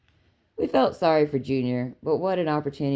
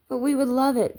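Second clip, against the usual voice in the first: eager interjection; 11 semitones higher than usual. A narrator's reading. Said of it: "broke in Jenny".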